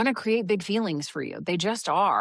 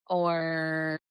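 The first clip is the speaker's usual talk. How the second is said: normal talking voice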